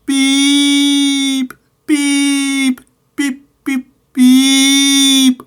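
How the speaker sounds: very high, loud shrieking whistle; ghostly shriek. Acted. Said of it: "he shrieked".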